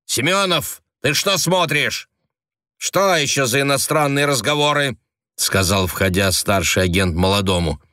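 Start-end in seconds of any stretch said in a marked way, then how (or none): 0.0-5.0 higher, scolding official; gruff agent. A narrator's reading. Senior agent scolding his subordinate, gruff voice. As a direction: bark at the junior agent.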